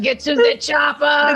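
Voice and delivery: mock action-hero shout; Arnie. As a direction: shout it like a hammy action star. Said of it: "Arnie's just gonna be like".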